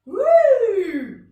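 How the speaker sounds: big, loud, high swooping whoop; bigger woo. Shown as a demonstration. Teacher tossing a cushion, big loud swooping woo. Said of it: "Oh, bigger noise".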